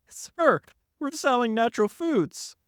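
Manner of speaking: very high-pitched mock insistence; exasperated insistence. Acted quarrel over the store.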